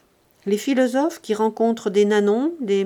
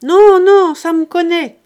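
loud, much higher shout back; shouted reply; 9 semitones higher, 14 dB louder than usual. Acted. Master shouts back to servant from the staircase, loud reply. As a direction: yell it from down the stairs.

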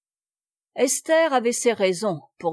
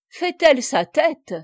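exclaiming; 5 semitones higher, 5 dB louder than usual. A narrator's reading. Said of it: "s'écria madame du Val-Noble".

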